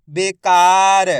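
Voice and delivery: teacher blending बेकार slowly for pupils; slowly blended word. Shown as a demonstration. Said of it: "मैं किस तरीके से एक एक अक्षर का उच्चारण करके".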